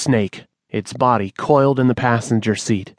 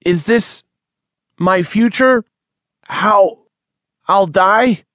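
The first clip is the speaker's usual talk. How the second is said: fearful questions; 9 semitones higher, 4 dB louder, slower than usual. Acted. Manny crushed, fearing death.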